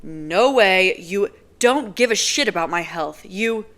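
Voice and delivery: loud, building fury; angry yelling at the woman he suspects; rising rage. Acted. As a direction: shout the accusations at her. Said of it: "rage building inside of me again".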